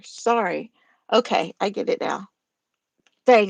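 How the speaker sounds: ordinary delivery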